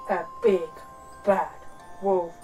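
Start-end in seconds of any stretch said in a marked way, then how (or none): none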